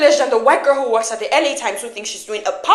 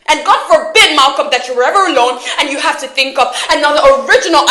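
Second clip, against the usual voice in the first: loud, scornful attack building to a shout; angry tirade; 3 semitones higher, 7 dB louder than usual. Acted. Acted quarrel, attacking his work.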